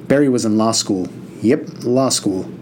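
usual speaking voice

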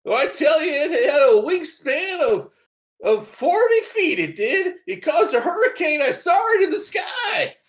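put-on high, loud, frantic old-timer shout; old prospector. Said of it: "grizzled old prospector".

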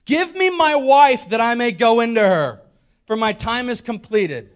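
loud, insistent quoted demand; blunt demand. Acted. Jacob demanding his wife from Laban, loud and blunt. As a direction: demand it loudly, no poetry at all.

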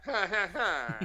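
villain laugh. Pretend. Showing how old villains sounded, mock evil laugh. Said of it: "that's how every villain sounded".

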